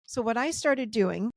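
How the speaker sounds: ordinary delivery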